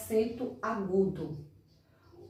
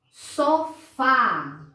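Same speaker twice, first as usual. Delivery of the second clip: "Sofá" in two separate beats, open á at the end; stressed open syllable; 2 semitones higher, 4 dB louder than usual. Shown as a demonstration. Teacher introduces a word to children, stressing "fá" in "Sofá". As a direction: split "Sofá" and land firmly on "fá". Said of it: "som aberto no fá".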